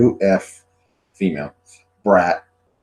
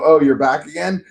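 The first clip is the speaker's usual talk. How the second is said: higher, exasperated greeting; retold mock surprise; 6 semitones higher, 3 dB louder than usual. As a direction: greet the pest yet again. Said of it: "I go".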